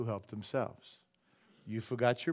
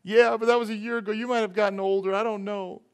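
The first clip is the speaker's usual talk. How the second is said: mock whiny excuse; 9 semitones higher, 7 dB louder than usual. Believer answering God, mock excuse.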